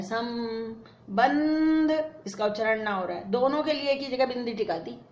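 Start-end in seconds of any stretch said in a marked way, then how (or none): 0.0-1.9 संबंध stretched very slowly, the nasal held as m; teacher stretching संबंध slowly; drawn-out pronunciation. Shown as a demonstration. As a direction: draw out संबंध slowly so the m sound is clear. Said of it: "इसका उच्चारण म हो रहा है".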